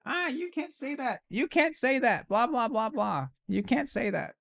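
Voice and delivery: high, mock childish whining; whiny child scream. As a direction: whine it like a bratty kid. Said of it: "they would scream like children".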